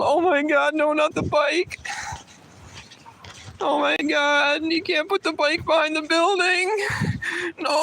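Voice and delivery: very high, loud mock shriek, slowed; mocking high yell. Mocking the store guy about his bike, with a high fake shriek. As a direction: squeal it like a panicked caricature. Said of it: "I yelled really loud".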